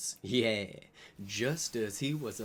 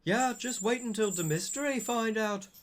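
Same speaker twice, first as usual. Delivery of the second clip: higher-pitched mock yell; raised, pitched-up mock yell; 8 semitones higher, faster than usual. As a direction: holler it up high in a hammed voice. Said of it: "Vampire yelled".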